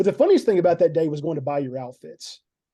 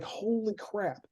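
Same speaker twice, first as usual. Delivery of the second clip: hushed disbelief; 3 semitones higher, 10 dB quieter, much slower than usual. Retold or imitated. Retold stunned reaction to casting.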